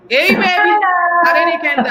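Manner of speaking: high, loud, delighted greeting; excited welcome. Real, not acted. Host greets her guest joining live, excited. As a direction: hype up the guest's arrival.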